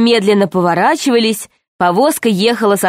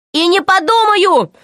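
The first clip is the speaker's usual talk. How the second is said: put-on high-pitched boyish outcry; indignant high yell, acted; defiant cry; 12 semitones higher than usual. A narrator's reading. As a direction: cry out a refusal, pitch leaping up. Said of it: "закричал Пашка".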